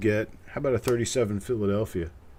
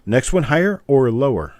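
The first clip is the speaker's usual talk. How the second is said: loud question; 3 semitones higher, 10 dB louder than usual. Real, not acted. Host asks Carl the game question.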